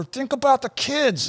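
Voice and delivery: high-pitched mock yell; imitated yelling, volume held back; retold yell. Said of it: "I even yelled at her".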